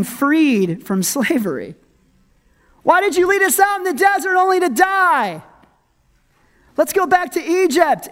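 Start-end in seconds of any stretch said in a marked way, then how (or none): 2.8-8.1 high-pitched, wailing complaint; mock whining fit. Israelites complain to Moses in the desert, whining fit. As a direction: play a crowd crying and whining. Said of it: "crying and whining and throwing a fit".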